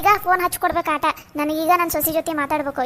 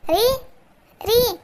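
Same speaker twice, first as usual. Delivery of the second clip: calling out; 5 semitones higher, much slower than usual. Acted. Calling husband from afar.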